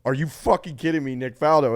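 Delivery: outraged disbelief at the broadcaster; angry. Retold or imitated. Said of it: "everyone on Twitter was like".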